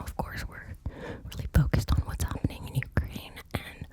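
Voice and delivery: ordinary talk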